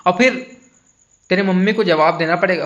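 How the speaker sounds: calling out loud and high to friends; shouting at a friend near the water to come back; retold shout. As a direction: yell a warning across to the waterfall. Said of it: "मैं चिल्ला के बोला".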